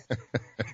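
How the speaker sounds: quiet little laugh; real amused chuckle. Real, not acted. Host amused by jackets at sixty degrees, quiet chuckle. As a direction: let out a soft, tickled chuckle. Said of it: "I'm laughing because this is really funny".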